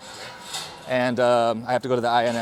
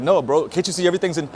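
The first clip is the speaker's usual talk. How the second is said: louder, higher imitation of an impatient officer; raised-voice mock reprimand; retold scolding; 5 semitones higher, 6 dB louder than usual. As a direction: act out telling someone off. Officer's side of retold quarrel.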